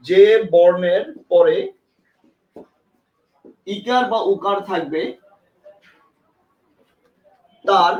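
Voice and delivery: slow, word by word, with long pauses; slow dictation. Shown as a demonstration. Teacher dictating the rule slowly to students. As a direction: dictate it slowly so they can write. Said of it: "লিখেন।".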